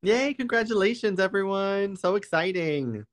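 high-pitched cheer for the winners; excited congratulations. Real, not acted. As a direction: celebrate them in a high, excited voice.

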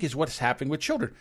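ordinary talk, nothing marked

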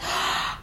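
a big sharp inhale through the mouth; deep gasp. Shown as a demonstration. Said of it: "Again, take a deep breath".

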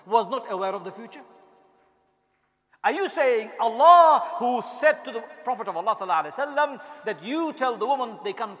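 impassioned sermon climax; fiery preaching. Acted. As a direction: thunder it at the congregation. Preaching at full force.

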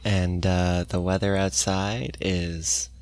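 low, soft, slow radio murmur; deadpan the weather like a hushed public radio host; mock NPR low talk. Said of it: "N P R style talking, like the low talking".

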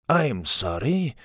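put-on Spanish-accented polite interruption, slow; a swordsman cutting in. A narrator's reading. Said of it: "in his Spanish accent".

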